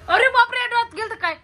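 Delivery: surprised. Real, not acted. Mock alarm at spicy reaction.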